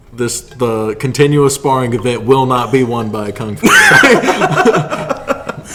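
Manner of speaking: mock quote. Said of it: "Your exact words were".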